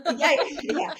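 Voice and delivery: high, laughing yeses; laughing yeah. Real, not acted. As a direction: laugh it off while agreeing. Laughing at the squirrel joke.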